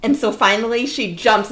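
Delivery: ordinary talk, nothing marked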